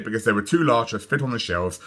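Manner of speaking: plain reading voice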